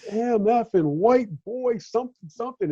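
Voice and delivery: high, mock cursing streak; imitated angry blue streak; angry tirade. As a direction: rattle off a retold string of insults. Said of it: "starts this blue streak of".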